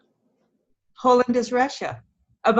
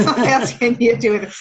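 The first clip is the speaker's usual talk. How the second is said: laughing repeat; 4 dB louder, faster than usual. Real, not acted.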